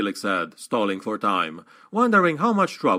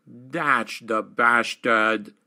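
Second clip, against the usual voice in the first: slow, lisping through gapped teeth; a slow snarl through missing teeth; much slower than usual. Acted. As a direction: spit it out slowly past broken teeth. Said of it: "Lars said through his missing teeth".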